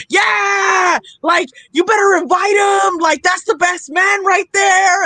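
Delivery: rowdy called-out approval; cheering guys. Retold or imitated. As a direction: imitate guys cheering from a crowd. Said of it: "guys going like".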